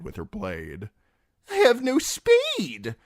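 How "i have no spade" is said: high, slow mocking fool; jester voice. The fool refusing Timion, high and mocking. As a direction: toss it back like a cackling jester.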